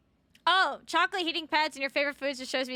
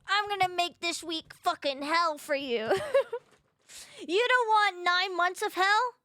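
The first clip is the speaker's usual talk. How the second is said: slow, high teasing jeers; mock taunting; 5 semitones higher, 3 dB louder, slower than usual. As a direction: needle them playfully, drawing it out. Mock taunting banter with chat.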